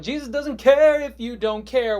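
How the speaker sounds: loud, high, drawn-out cry; shouted refrain. Acted. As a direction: belt it out slowly as the climax. Third take, refrain at its peak.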